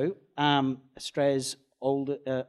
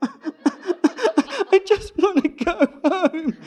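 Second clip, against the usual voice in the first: much higher, louder, very slow laughing plea; laughing whine; 11 dB louder, much slower than usual. Retold or imitated. Driver pleading to go home, laughing whiny plea. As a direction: moan it through laughter, defeated. Exhausted plea after the whole ordeal.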